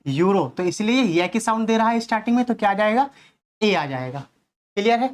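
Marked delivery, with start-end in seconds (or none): 0.1-0.5 "यूरो" as "yoo-ro", falling lower; correct pronunciation. Shown as a demonstration. Teacher confirming the word for students, "यूरो" as "yoo-ro". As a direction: answer "यूरो" with an initial y sound. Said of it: "क्या है ये?".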